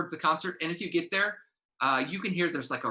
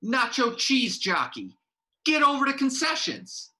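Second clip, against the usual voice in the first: bossy yell; 10 semitones higher, 4 dB louder than usual. Acted. Stage manager barking at a player, loud. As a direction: order them off like a drill boss.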